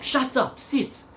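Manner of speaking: high-pitched imitation of a bossy policeman; mock angry order. Policeman ordering a hitchhiker into the car, high mock anger. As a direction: fire off commands in a high mock-officer voice.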